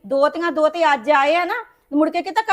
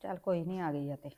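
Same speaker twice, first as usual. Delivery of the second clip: much softer, low resigned mutter; under her breath; 11 semitones lower, 18 dB quieter than usual. Acted. Mother mutters to herself as daughter-in-law walks in, very soft. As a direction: breathe it out barely audible.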